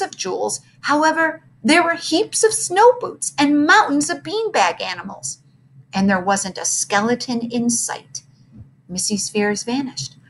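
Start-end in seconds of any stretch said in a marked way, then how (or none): none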